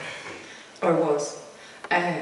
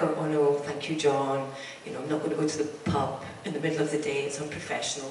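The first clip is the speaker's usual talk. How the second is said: lower, softer and quick, a prissy polite decline; mock prim refusal; 6 semitones lower, 6 dB quieter, faster than usual. Colleagues turning down John's lunch wine, mock prim refusal. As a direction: send up the over-proper colleagues. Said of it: "loads of people are like".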